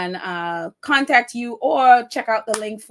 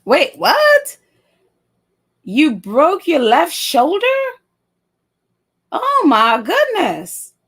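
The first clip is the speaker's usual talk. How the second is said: high, loud, slow gasps of real dismay; stunned by the news, draw out each exclamation; shocked; 7 semitones higher, 6 dB louder, slower than usual. Real, not acted. Viewer reveals broken shoulder.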